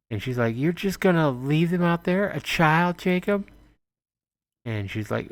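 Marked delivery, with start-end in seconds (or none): 0.6-3.5 high pitched disbelieving wife; incredulous woman. Acted. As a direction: plead with him in disbelief. Anna pleading with Jacob.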